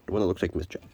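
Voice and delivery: ordinary talk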